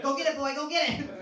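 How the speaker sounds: high, loud, eager call to a dog. Retold or imitated. Dad calling to son in the pool, high eager dog-call. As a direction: hype him up like you're throwing a ball for a pup.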